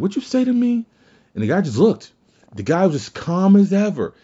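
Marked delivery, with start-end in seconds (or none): 0.0-0.9 pitched high, a retold affronted snap; mock indignant challenge. Angry man confronting the calm neighborhood OG, high mock challenge. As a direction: imitate an offended man squaring up.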